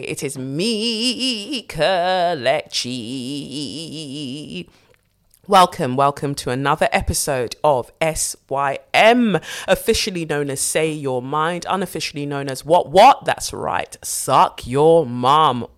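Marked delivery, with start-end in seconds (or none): 0.0-4.7 slow drawn-out intro. Acted. 13.2-15.7 cheeky drawl. Acted. Cheeky joke title, drawn out.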